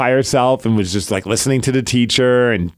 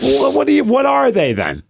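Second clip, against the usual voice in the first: high-pitched, stammering comeback; retold exasperation with a child; exasperated dad; 11 semitones higher than usual. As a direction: plead for an answer, exasperated. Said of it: "I'm like".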